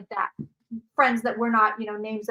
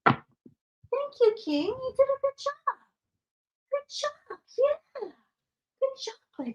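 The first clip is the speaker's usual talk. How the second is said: dog praise voice; 13 semitones higher, slower than usual. Real, not acted. Owner praising her guard dog King, high baby talk. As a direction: coo up high like praising a pet.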